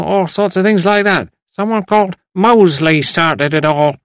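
put-on high-pitched old man groping for names; dismissive, then chatty elderly rambler; rambling old man. A narrator's reading.

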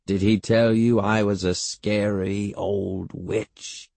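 slow, drawn-out teasing; mock-spooky great-aunt. A narrator's reading. Great-aunt teasing the boy about being scary, slow and spooky. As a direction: spin it out like a scary tale.

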